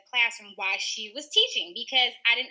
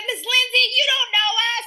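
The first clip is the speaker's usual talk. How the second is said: put-on kids teasing teacher; 9 semitones higher, 8 dB louder than usual. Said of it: "They said".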